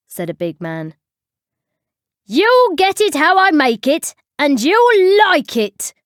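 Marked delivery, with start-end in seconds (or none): 2.3-5.9 loud, high bellow; booming. A narrator's reading. Tea lady ordering a customer from her caravan, booming. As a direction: boom it out fiercely, pitch way up. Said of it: "boomed a voice from inside the caravan".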